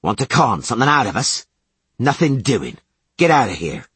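bully him out the door; brutish shouting guard. Acted. Commandant yelling.

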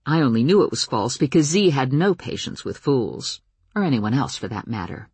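usual speaking voice

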